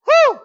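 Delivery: very high, loud "woo"; piercing preacher's whoop; exultant whoop. Real, not acted. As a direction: holler a short whoop after the big shout. Whoop after his great shout.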